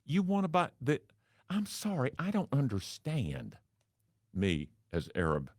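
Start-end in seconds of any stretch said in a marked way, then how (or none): 0.0-3.6 much higher, sugary and puzzled; a confused but cheerful receptionist; high Southern receptionist voice; sweet receptionist. Retold or imitated.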